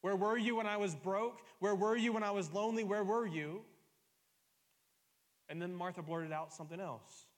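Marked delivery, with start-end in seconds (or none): 0.0-3.6 loud, high pleading questions; raised, aching prayer of complaint; anguished cry to God. Acted. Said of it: "when you said".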